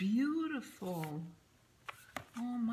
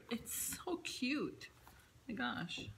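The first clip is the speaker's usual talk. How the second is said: whispered, a little higher; soft whisper; 4 semitones higher, 3 dB quieter, slower than usual. Real, not acted. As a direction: hush it out in delight. Admiring a gift, hushed.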